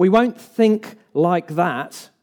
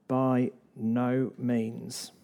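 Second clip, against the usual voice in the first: slow and much softer, each word set apart; quiet emphatic answer; 3 semitones lower, 8 dB quieter, much slower than usual. Acted. Preacher answering temptation, hushed and slow. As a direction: drop the voice and lay down "By no means" slowly. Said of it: "Paul is emphatic".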